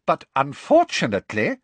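high, tightly polite; strained civility; forced smile. Acted. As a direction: feign pleasantness through annoyance. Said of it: "with an attempt at a reciprocal smile".

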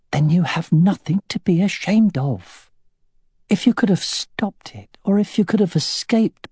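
high, earnest, reassuring woman; tender wife. A narrator's reading. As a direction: comfort him firmly but tenderly. Katrina reassuring Roran.

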